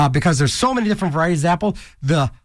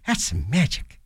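usual speaking voice

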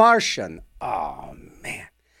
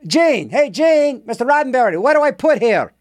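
mock calling out; 7 semitones higher than usual. DJ calls out to Roddenberry.

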